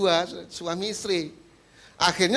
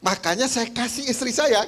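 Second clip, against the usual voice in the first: higher, chuckling fondly; laughing husband; 8 semitones higher than usual. Acted. Husband explaining to pastor why he gives fish heads, laughing. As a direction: laugh through it, pleased with himself.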